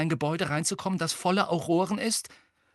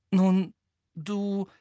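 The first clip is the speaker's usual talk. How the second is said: higher, hesitant stalling; uneasy; 5 semitones higher than usual. A narrator's reading. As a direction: falter uneasily, pausing between words. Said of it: "sie blickte nur noch beklommener".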